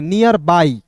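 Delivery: "नियर बाई" with a drawn-out ending on बाई; "बाई" held long and falling after "नियर"; correct reading. Shown as a demonstration.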